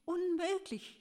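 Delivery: hoher, entsetzter Ausruf; entsetzter Aufschrei. A narrator's reading. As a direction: schrei es fassungslos in die Höhe. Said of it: "schrie er". (hoher, entsetzter Schrei)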